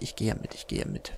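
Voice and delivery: trailing off resigned whisper; fading to a whisper. Real, not acted. (leiser werdend bis zum Flüstern)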